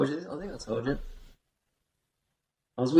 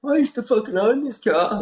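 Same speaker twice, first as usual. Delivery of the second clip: boasting stoner; 10 semitones higher than usual. Retold or imitated. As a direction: crow it like a proud stoner. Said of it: "the dude's going".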